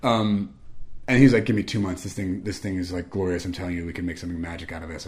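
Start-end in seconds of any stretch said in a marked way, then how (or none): 1.4-5.1 low, soft, earnest urging; quietly intense. Retold or imitated. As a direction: imitate an intense friend's hushed pitch. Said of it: "with that intensity".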